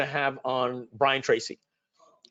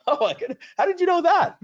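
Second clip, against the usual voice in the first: much higher, louder, astonished gasp of a question; mock amazement; 5 dB louder than usual. Podcast guest amazed the host knows his hobby, hammed-up astonished, high and loud. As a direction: play the stunned guest caught off guard.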